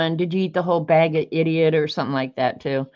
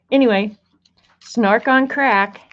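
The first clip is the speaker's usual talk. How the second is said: normal talking voice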